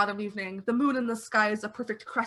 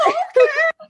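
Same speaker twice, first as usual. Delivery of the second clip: laughing; 13 dB louder than usual. Real, not acted. Laughing through.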